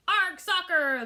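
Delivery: high, very loud yell; the whole crew bellows back. A narrator's reading. Crew echoes the captain, high loud yell. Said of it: "the crew yelled".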